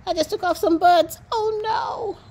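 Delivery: real dismay at her slip. Real, not acted.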